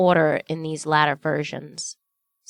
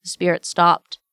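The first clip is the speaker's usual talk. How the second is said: usual speaking voice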